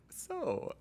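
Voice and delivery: hushed, high therapist prompt; a soft therapist's opening word; calm crisis counselor. Retold or imitated. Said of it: "that's my impression of a therapist".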